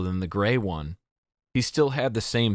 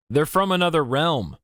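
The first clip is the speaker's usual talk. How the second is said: put-on higher, louder old man calling from the crowd; groggy old voice; 7 semitones higher, 3 dB louder than usual. A narrator's reading. Old man in the crowd speaking up for the children, aged and raised. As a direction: crackle it out like an old villager. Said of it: "a groggy, old-sounding voice said".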